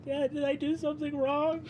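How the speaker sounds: put-on a little boy breaking into tears; crying boy. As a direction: son cries to his father, frightened he did wrong. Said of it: "Kier starts crying and goes".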